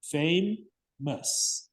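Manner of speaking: "Famous" pulled apart into two beats with a clear gap; broken into syllables. Shown as a demonstration. Teacher confirming the syllable count, "Famous" split in two. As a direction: say "Famous" as fay, pause, mus. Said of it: "how many syllables does it have?".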